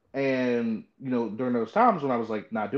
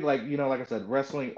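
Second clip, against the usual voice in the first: ordinary talk, nothing marked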